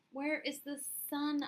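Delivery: crying out. Acted. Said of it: "I cried".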